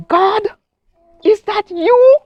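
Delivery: high, startled call upward; startled question. Retold or imitated. Woman hearing a voice in her empty house, startled call. Hears a voice, turns around.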